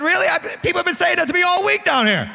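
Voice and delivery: high, quick comic disbelief; incredulous dismay. Retold or imitated. Visiting preacher learns what "bless your heart" means, comic incredulous dismay. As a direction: realize you've been insulted all week. Recalls his dismayed reply to pastor.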